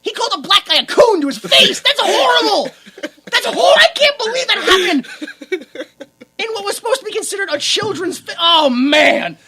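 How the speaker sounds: high, loud, laughing disbelief; appalled amusement. Real, not acted. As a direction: cry out in scandalized glee. Appalled laughing rant about a film.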